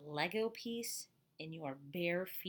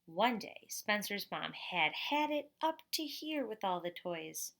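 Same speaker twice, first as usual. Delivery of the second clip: put-on lively storybook voice for kids; reading to children. A narrator's reading. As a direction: read it out to the class like a picture book. Reads picture book to class.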